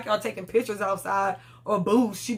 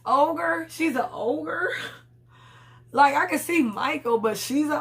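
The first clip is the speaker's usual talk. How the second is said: high, slow, disbelieving "She's an ogre?"; incredulous outrage; 5 semitones higher, slower than usual. Real, not acted. Vlogger reacting to the ogre insult, incredulous and drawn out. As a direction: stretch it out in shocked disbelief.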